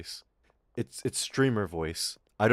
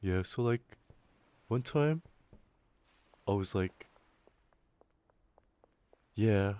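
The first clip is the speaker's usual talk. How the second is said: boring streamer; 4 dB quieter, slower than usual. Retold or imitated. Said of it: "what he's talking about is like".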